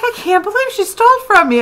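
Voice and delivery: high disbelieving outcry; disbelief. Retold or imitated. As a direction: replay the shock of being robbed. Retelling outrage at theft.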